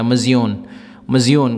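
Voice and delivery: saying مزیون slowly with a clear Gulf vowel, mə-zi-yoon; Gulf Arabic word. Shown as a demonstration. Teacher modelling the Gulf word مزیون for students. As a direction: model مزیون for learners, syllable by syllable. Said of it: "ہم بولیں گے مزیون".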